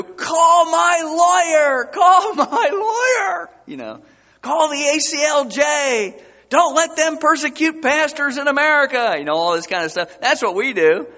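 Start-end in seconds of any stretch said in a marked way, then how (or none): 0.0-3.5 high, loud, crying mock outrage; hammed-up cry for a lawyer; mock whining complaint. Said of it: "We yell and complain and whine". 4.4-9.1 a hint of indignant whining; high, loud mock protest; hammed-up outraged believer; mock complaint. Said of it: "We yell and complain and whine".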